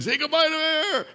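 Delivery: high-pitched mimicked bellow; megaphone yell. Dad dunking his little boy, imitated megaphone yell. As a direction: imitate a big coach calling out an order. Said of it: "like, megaphone voice".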